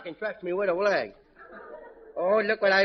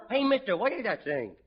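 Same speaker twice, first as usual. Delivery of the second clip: usual speaking voice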